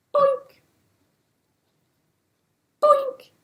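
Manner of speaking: very high cartoon boink. A narrator's reading. Storyteller making the fruit go boink, very high. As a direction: pop each boink up high.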